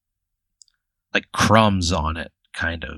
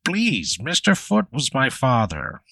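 high, swooping creature voice; Bigfoot voice; 4 semitones higher than usual. Acted. Bigfoot corrects the player on his name, high creature voice. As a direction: lift the pitch like a genial creature. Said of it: "He says".

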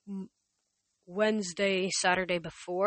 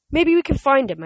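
eager; 8 semitones higher, 11 dB louder than usual. Acted. Percy eager suggestion.

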